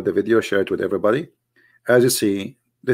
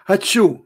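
a hammed-up achoo; mock sneeze; 8 semitones higher than usual. Shown as a demonstration. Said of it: "Just to let you know".

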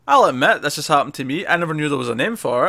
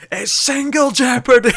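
high, loud shouted retort; Denise shouting back; 11 semitones higher, 6 dB louder than usual. Retold or imitated. Agent shouting back at an angry suspect, high imitated yell. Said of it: "Denise shouting back".

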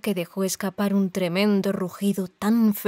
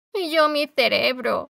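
higher, plaintive moan; 5 semitones higher than usual. A narrator's reading. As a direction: whimper it out. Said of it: "gimió el espantapájaros". (gemido lastimero)

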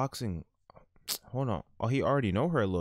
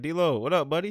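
loud, cheery call to a buddy; loud greeting; 8 semitones higher, 7 dB louder than usual. Pretend. Streamer voicing his character, calling out loud to D-Lo. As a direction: shout a friendly hello across the gym.

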